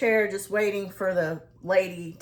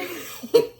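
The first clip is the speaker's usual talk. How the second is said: delighted laugh; 9 semitones higher, 3 dB louder than usual. Real, not acted. Laughing at the toddler.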